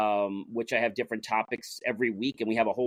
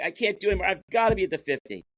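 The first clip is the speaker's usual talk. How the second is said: higher, strained cry of effort; a pitched-up, gasping plea; straining player; 6 semitones higher, much faster than usual. Retold or imitated. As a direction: spent but pushing on. Exhausted player crying out.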